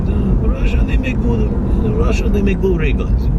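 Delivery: high, broken-English Croatian dealer voice; Croatian accent impression. Vlogger imitating the Croatian seller pitching a ray gun, Croatian accent. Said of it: "He talked like this".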